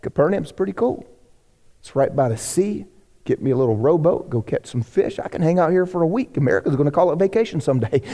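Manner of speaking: lightly voiced lazy vacationer; lazy daydream. Jesus imagining staying on holiday, low and lazy. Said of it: "he might have been like".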